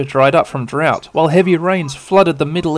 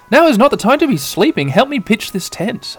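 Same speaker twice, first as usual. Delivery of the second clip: lightly voiced, higher-pitched scolding; bossy Sora; 9 semitones higher than usual. Sora scolding sleepy Tai, higher and bossy. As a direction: nag him to get up and help. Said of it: "Sora Takanuchi is like".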